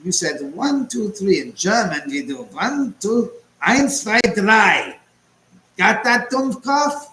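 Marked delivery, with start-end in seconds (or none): 0.0-1.4 put-on German accent. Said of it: "from Inglourious Basterds". 2.5-7.1 hammed-up German accent, higher and louder, counting eins zwei drei; mock German officer. Streamer imitates the Inglourious Basterds counting scene in a German accent. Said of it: "from Inglourious Basterds".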